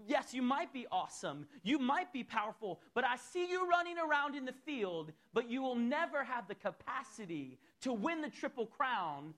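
fervent full-force sermon voice; preaching. Acted. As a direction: preach it out, high and loud. Preaching to congregation, raised voice.

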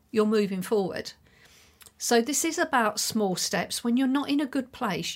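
ordinary talk

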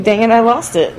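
ordinary delivery

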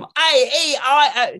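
"A E I O" high, loud, drawn-out in Cockney; mangled Cockney vowels. Acted. Flower girl before training, "A E I O" in wailing Cockney. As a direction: wail "A E I O" slowly in bad Cockney. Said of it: "She goes from".

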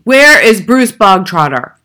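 slow, bellowed demand; Trunchbull's bark. A narrator's reading. Headmistress hunts for a boy in assembly, barking. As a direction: roar it out, drawing the words long. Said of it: "the Trunchbull barked suddenly".

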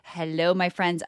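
usual speaking voice

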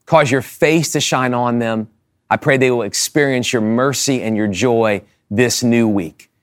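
earnest, projected closing prayer, falling to a low Amen; prayer of blessing. Real, not acted. Pastor blessing the congregation as they go, earnest prayer. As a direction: pray steadily over the whole congregation.

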